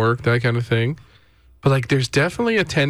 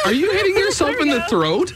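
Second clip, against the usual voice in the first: laughing; 10 semitones higher than usual. Real, not acted. Laughing at bagpipe attempt.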